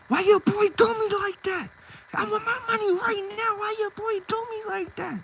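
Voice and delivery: high-pitched, indignant demand; acting out an outraged complaint; angry confrontation. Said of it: "I'm mad".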